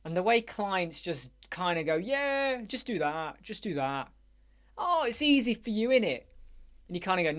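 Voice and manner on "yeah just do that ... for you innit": mock pushy client. Client voiced demanding.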